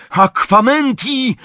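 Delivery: high, loud, drawn-out incantation; roared spell. A narrator's reading. Harry roaring a spell against the flames. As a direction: bellow the spell, pitched high. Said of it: "ryknął Harry".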